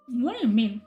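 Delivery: ordinary talk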